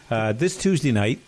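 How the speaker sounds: ordinary talk, nothing marked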